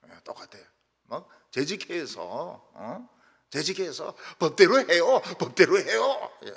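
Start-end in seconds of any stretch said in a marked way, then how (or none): 4.4-6.3 mock Seoul committee member, loud demand; Seoul-accent quarrel. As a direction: loudly insist in standard Seoul Korean. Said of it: "서울 말로 싸우더라고요".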